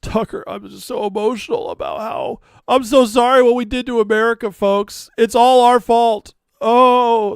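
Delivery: high, loud mock-tearful apology; mocking Tucker. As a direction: ham up a fake emotional confession. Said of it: "He's fake crying".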